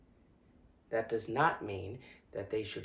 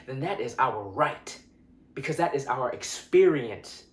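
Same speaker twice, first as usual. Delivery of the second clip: loud, raised-pitch confrontation; heated, insistent and louder; impassioned rebuke; 3 semitones higher, 5 dB louder than usual. Acted. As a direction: tell them off forcefully.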